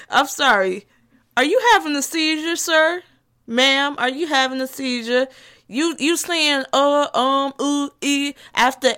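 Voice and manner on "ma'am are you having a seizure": normal talking voice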